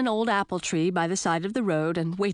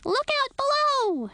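much higher squeaky shout. A narrator's reading. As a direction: yell a warning, bat-like. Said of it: "shouted Lil".

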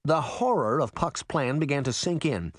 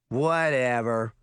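teasing, grinning; 2 semitones lower, slower than usual. A narrator's reading. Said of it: "Daphne grinned".

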